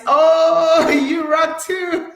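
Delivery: loud, laughing burst of thanks; delighted laugh. Real, not acted. Thanking Instagram viewers, laughing loud and delighted.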